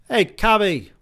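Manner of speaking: very high, loud hail; yelled. A narrator's reading. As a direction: call out for a cab. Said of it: "Celine yelled".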